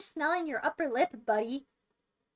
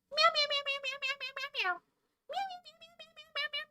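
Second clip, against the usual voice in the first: very high, soft rapid-fire meows; squeaky little cat chant; kitty cat; 11 semitones higher, 5 dB quieter, faster than usual. Pretend. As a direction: mew like a tiny kitten on repeat.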